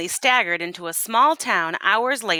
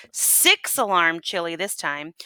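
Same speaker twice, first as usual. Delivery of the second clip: ordinary delivery, nothing marked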